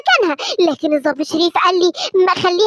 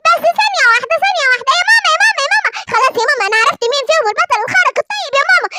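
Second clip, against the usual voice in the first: put-on higher excited child voice calling out; little boy calling mum; 6 semitones higher than usual. Simba calling his mother to tell her the secret, higher excited child voice. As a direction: kid shouting for his mother.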